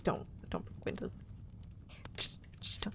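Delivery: a hushed begging whisper; whispered plea. Acted. Whispering to the chat not to break windows. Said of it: "whispered plea after saying it".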